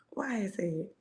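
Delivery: unvoiced hushed whisper. Whispering to Spirit asking why. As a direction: breathe the question out.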